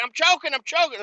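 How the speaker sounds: high, hurried imitation of someone choking; mock panicked cry. Worker got it around his neck, mock panicked cry. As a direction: play up a coworker's panic in a high voice.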